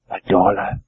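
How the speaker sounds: low, quiet echo of A dollar; a second bidder echoing from the crowd; a bidder repeating the bid, low and quiet; a lightly voiced second bidder. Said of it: "what do you bid me".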